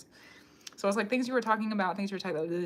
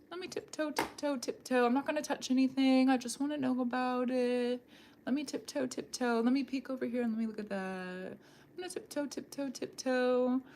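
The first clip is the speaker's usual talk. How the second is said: higher-pitched tiptoe patter; play-acted careful creeping; tiptoeing snooper; 4 semitones higher than usual. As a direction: sneak along in a light, lilting little voice. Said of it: "you're kinda, like, tiptoeing".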